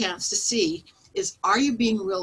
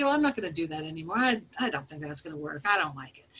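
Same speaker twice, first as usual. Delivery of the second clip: lower, quicker grumbling excuse; reluctant business owner; 5 semitones lower, 5 dB quieter, faster than usual. Retold or imitated. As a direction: mutter the excuse under your breath. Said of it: "or if you just kinda say".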